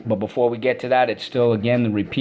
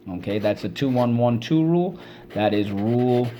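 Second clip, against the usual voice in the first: usual speaking voice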